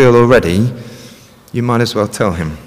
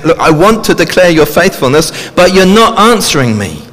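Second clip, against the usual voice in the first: higher, louder, pressing the accusation; angry protest; 6 semitones higher, 5 dB louder than usual. Retold or imitated. Psalmist cross-examining God, higher and louder. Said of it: "I think he's angry with God".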